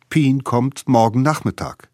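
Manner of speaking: ordinary delivery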